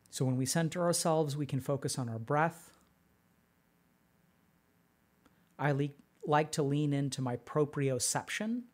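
calm, unhurried meditation guide; guided meditation. Acted. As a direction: guide them gently inward. Leads a meditation.

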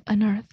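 "unearthed" with the n flowing into earth; linked pronunciation. Shown as a demonstration. Confirming the linking to the co-host, joined-up "unearthed". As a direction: join on and earth smoothly in "unearthed". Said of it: "把on跟earth连起来了".

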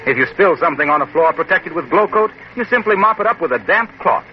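normal talking voice

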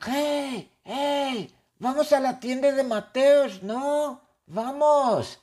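high-pitched yell to someone flying alongside; acted shouting. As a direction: call out urgently, way up high. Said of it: "Connor le grita a Pablo y dice". (gritando con voz muy aguda)

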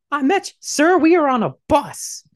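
high, loud put-on protest; mock outraged passenger. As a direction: act out an appalled reply to a creep. Acts outraged reply to creep.

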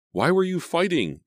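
high, strained, choking question; choked out. A narrator's reading. Sydney asking Emma over Jason's body, choking. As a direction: force it out through a tight throat. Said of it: "she choked out".

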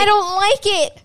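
high, loud, drawn-out whine of protest; stretched-out, hammed-up complaint; mock protest. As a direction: object like a stubborn kid, playfully. Playful protest about moving topic.

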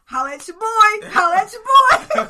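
excited shout. Retold or imitated. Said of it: "I was like".

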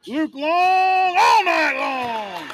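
slow, loud stretched-out call; drawn-out announcer shout. Real, not acted. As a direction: belt the name out slowly like an arena announcer. Announcer calls a player's name.

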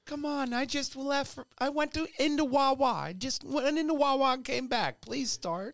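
high, exasperated begging; pleading driver. Pretend. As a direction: beg the car to start, high and exasperated. Driver begging the car to start.

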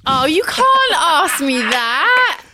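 laughing, drawn-out protest; half-laughing can't-ask-me-that. Real, not acted. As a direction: laugh through the refusal, slowly. Protesting impossible choice.

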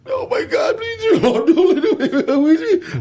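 high, pleading cry of fear; inner panicked prayer. Retold or imitated. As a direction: beg the Lord in a high panicked voice.

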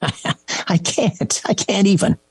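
high, laughing exasperation; laughing disbelief. Real, not acted. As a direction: laugh it off, can't believe it.

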